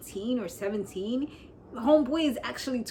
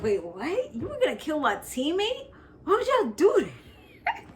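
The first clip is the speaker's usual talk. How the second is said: high-pitched disbelief, rising on each question; baffled outcry with pitch leaping up; mock incredulous; 6 semitones higher than usual. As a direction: act out a stunned, protesting reaction. Acts out Zeke's shocked questions.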